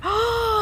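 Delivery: sharp happy intake of breath; delighted gasp. Real, not acted. Gardener spots her first raspberries, delighted gasp. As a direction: gasp at a surprise find.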